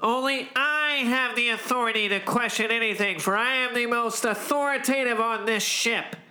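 pompous commander pronouncement; air of authority. Acted. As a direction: state it as the unquestioned authority aboard. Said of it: "air of authority that only I possess".

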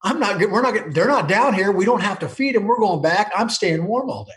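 put-on a kid thrilled the cows stayed up in the draws; delighted he can skip the work; gleeful thinking. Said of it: "So I'm thinking".

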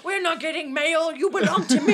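loud high mock despot through laughter; roaring tyrant king. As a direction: bellow it like a petty tyrant. Playing neighborhood king.

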